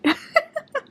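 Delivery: sudden high laugh; burst of laughter. Real, not acted. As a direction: break into a high real laugh. Reading funny annotated line aloud.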